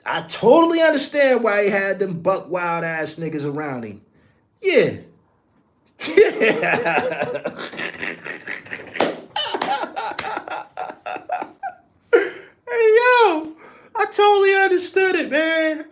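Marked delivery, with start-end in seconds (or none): 4.6-15.9 high, crying with laughter; breaking up as you talk; laughing hard. Real, not acted. Host cracking up at the Suge Knight story, high crying laughter.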